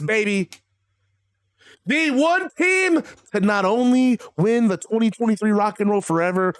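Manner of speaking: normal talking voice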